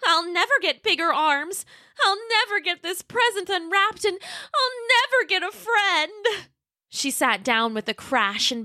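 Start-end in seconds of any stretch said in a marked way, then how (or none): 0.0-6.5 high, slowing, tearful little T-Rex; Roxy near tears. Acted. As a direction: let the voice crack on the last never. Roxy begins to cry.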